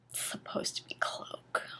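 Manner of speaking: soft whispered correction; a quiet muttered correction to the listener; whispered aside. Real, not acted. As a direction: lean in and whisper the fix. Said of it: "aside correcting the text".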